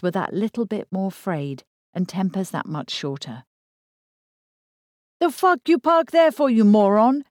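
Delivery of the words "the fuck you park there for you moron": loud, high yell from the van; angry yell. A narrator's reading. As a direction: yell it like a furious driver. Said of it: "yelled the bearded man".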